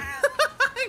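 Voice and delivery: very high burst of laughter; high giggle. Real, not acted. Streamer cracking up at San Pedro appearing. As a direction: crack up helplessly.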